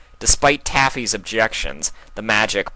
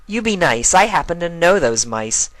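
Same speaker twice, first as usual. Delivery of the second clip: higher mare voice mock-scolding; playful scold; 10 semitones higher, 5 dB louder than usual. Twilight playfully scolding the colt, higher and teasing. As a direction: wag a finger playfully.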